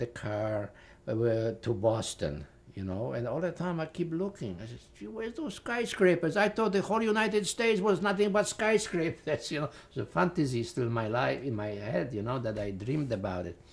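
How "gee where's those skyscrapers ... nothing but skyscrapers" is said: higher, wondering where the skyscrapers went; puzzled surprise. Retold or imitated. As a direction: naive newcomer amazed and let down.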